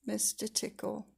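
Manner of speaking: ordinary delivery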